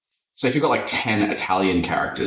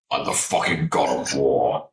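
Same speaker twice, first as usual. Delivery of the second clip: low, gruff god-of-war boast; a lower, hammed-up Kratos boast; mock Kratos; 6 semitones lower than usual. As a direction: boom it out deep like a war god. Kratos impression again.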